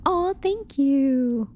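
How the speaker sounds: high, slow, drawn-out aw; moved almost to tears; touched aw. Real, not acted. Touched by chat compliment.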